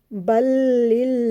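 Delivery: बलिल्लाहि with the लाम of अल्लाह said thin and light; slow tajweed recitation; thin lam. Shown as a demonstration. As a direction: chant it slowly with a light, thin ल. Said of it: "तो उसे हमने बारीक पढ़ना होता है".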